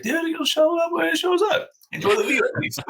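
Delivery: high-pitched joking calm, brushing off worry; mock high reassurance. To his frustrated hunting buddy, a high, breezy mock reassurance. Retelling his own easygoing reply.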